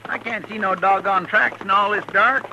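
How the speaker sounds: loud, high complaint carried across the dark; calling out. Acted. Chester calling to Dillon about the tracks, loud and high. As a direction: shout your worry over the distance.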